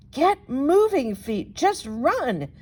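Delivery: frantic cry. Acted. Bunny fleeing the dragon.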